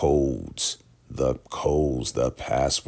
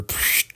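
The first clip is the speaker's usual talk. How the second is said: hissy smack sound effect; imitated smack. Ruth smacking Lacey, imitated slap sound. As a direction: make a slap noise with the mouth. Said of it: "Ruth has to smack her".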